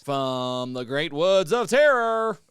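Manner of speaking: slow, loud, booming announcement; announcer voice. Acted. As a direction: drag it out like a ring announcer. Hyped announcement of guest.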